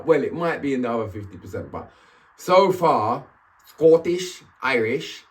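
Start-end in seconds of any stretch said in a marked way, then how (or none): 3.7-4.4 put-on brief go at a Scottish accent; amateur Scottish accent. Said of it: "I can't really do the Welsh accent either". 4.6-5.2 put-on brief go at an Irish accent; amateur Irish accent. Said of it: "I can't really do the Welsh accent either".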